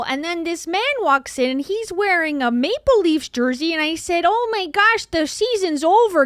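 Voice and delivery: put-on Canadian accent. Streamer doing a Canadian accent story for a viewer. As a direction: spin a silly Canadian yarn in an exaggerated Canadian accent. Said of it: "do my Canadian accent just for you".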